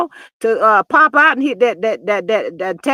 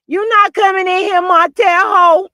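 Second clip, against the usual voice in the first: sassy dismissal; 8 semitones higher, 6 dB louder than usual. Retold or imitated. Mel shutting the door on Martell with sass. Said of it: "can't nobody say that name like Mel".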